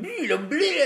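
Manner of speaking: high silly cartoon bleat; Ace Ventura noise. Kid copying Ace Ventura, squeaky silly noise. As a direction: imitate a goofy rubber-faced noise. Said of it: "And he just went".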